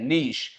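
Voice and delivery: नीश said low as 'neesh'; correct pronunciation. Shown as a demonstration. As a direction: answer with नीश, long ee and final 'sh'. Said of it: "इसका जो उच्चारण है". (नीश, 'श' पर ख़त्म होता उच्चारण)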